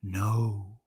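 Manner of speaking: low, hushed, drawn-out "no"; storybook near-whisper. A narrator's reading. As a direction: say it softly under your breath. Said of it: "Charlie whispered".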